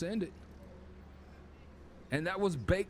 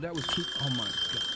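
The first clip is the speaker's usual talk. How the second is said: usual speaking voice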